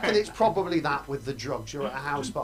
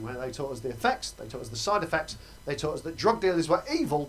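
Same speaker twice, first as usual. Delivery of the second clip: ordinary delivery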